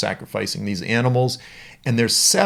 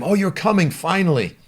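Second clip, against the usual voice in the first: impatient Balak; 8 semitones higher than usual. Acted. Balak greeting Balaam impatiently.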